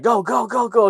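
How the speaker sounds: high, loud, rapid chant; mock frantic urging. Narrator as family fleeing the truck, frantic urging. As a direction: urge a getaway in put-on panic.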